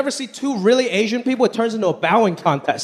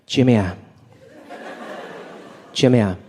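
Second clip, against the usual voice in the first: put-on Chinese mom accent; 9 semitones lower than usual. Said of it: "She was like".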